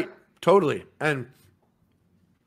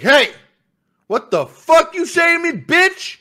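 loud, high-pitched indignant challenge; mock-outraged yell; confrontational outburst; 12 semitones higher, 9 dB louder, slower than usual. Substitute teacher challenging a mouthy kid, loud confrontational outburst.

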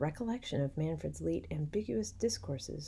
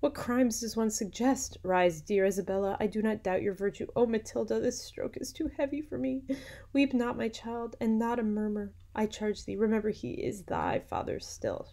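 higher, theatrical voice of a distressed wife; a wronged mother's raised, pleading voice; distressed Hippolyta; 6 semitones higher, 4 dB louder than usual. A narrator's reading. Hippolyta consoles her daughter and Isabella, distressed cry. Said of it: "cried Hippolyta".

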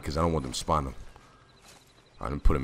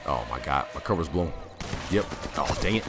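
ordinary delivery

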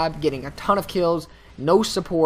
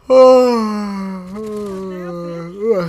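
long, loud open-mouthed yawn; noisy, indulgent yawn; signature yawn; 5 semitones higher, 7 dB louder than usual. Real, not acted. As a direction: let out a big drawn-out yawn, high then sinking. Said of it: "Signature yawn".

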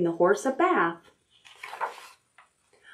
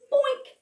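a very high cartoon boink; silly boink. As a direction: pop out a comic bump sound. Sound effect for the bump.

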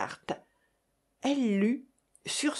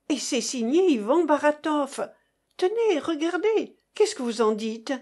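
indignant mother; 6 semitones higher, 4 dB louder than usual. A narrator's reading. Mother outraged to friends.

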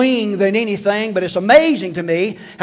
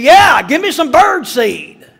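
slow, higher, louder blunt order; rude demand; 7 semitones higher, 6 dB louder, slower than usual. Retold or imitated. Customer rudely orders from the clerk, loud and slow. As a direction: bark it like a cocky kid.

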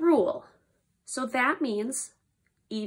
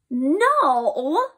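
"No" loud and stretched, pitch swooping; long emphatic "No" with a swoop; exclaimed question; 5 dB louder than usual. Shown as a demonstration. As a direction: cry "No" in disbelief. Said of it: "a question mark and an exclamation".

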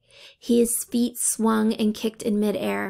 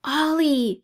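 higher, urgent call of her name; alarmed cry; 5 semitones higher than usual. A narrator's reading. Brian cries out to Ollie as the door gives, alarmed. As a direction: warn her the door is giving. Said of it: "he cried".

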